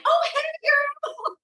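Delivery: very high, sing-song hello to a friend; mimicking her own high bubbly phone voice; sweet phone greeting. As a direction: gush like answering a call from a girlfriend. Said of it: "you answer the phone like it's somebody".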